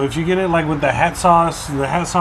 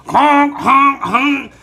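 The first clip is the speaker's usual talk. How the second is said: high, louder honking; imitating geese honks; 9 semitones higher, 4 dB louder, much slower than usual. Geese hit by the car, high mock honks. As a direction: honk like a goose.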